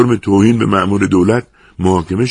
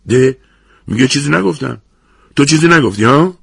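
high, raised-voice mocking challenge; incredulous, pitched-up confrontation; angry taunt; 7 semitones higher, 5 dB louder than usual. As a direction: throw the denial back angrily. Angry opponent mocks the denial.

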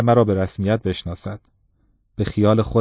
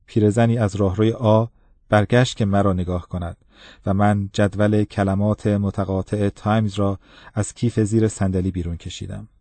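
usual speaking voice

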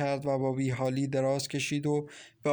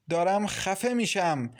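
slow, higher-pitched exasperation; mild anger, dragging the words out; angry; 5 semitones higher than usual. A narrator's reading. Farzaneh suffocating beside the man, angrily fed up. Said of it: "با عصبانیت گفت".

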